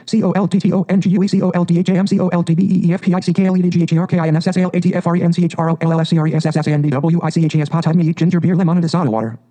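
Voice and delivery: a gabbled picnic inventory; rattled-off list. Acted. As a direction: reel it all off in one excited breath. Rat rattles off the lunch list.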